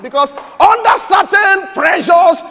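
loud, high preaching burst; preacher shouting a rebuke, then dropping. Acted. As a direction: thunder it at the congregation. Preaching at full force.

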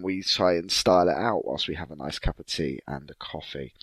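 ordinary delivery

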